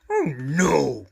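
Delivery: loud dismayed monster cry; Grover crying out. A narrator's reading. Grover finding his lunch missing, loud and dismayed. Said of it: "cried Grover".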